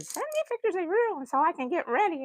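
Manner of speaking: fast, high, lightly voiced eager friend; quoting Allegra. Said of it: "she said".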